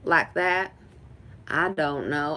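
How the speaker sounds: Texas accent with a twangy drawl; Texas twang. Shown as a demonstration. Showing off the accent asked about, Texas twang. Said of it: "a Texas accent or, like, a, a twang".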